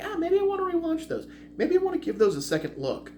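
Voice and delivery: high-pitched, tentative inner thought; musing aloud. Retold or imitated. Reviewer weighing a rewatch of a trilogy, musing high. Acts out his own wavering thought.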